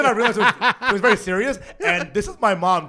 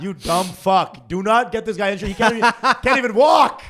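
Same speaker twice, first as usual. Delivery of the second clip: loud, higher, exasperated outburst; outraged shouting; 6 semitones higher, 8 dB louder than usual. Acted. Cousin warning him off the insurance, outraged shouting. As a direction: hammer it out in disbelief, loudly.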